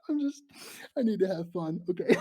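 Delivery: high teary laugh through words; laughing nervously. Real, not acted. Streamer laughing nervously to calm herself. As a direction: laugh off the fear.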